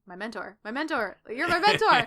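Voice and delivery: gushing excitement. Retold or imitated. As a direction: swell up with adoration on each "mentor". Peter gushing over Tony.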